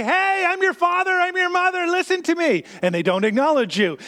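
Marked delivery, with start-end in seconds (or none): 0.0-2.6 put-on parent catching kid. Parent calling to a child drawing on the wall, high and stern. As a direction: call out to the kid like a parent caught off guard. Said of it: "And you say".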